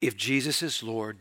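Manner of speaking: ordinary talk, nothing marked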